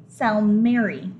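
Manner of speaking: plain reading voice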